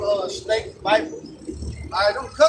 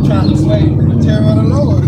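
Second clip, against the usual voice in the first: loud preaching shout; fired-up shout; 13 dB louder than usual. Real, not acted. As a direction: boom it like a street preacher.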